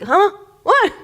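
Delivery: a high, jolted "Huh? What?"; startled huh. Retold or imitated. Daydreaming child yanked back by her father's call, startled. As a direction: snap out of a daydream, caught off guard.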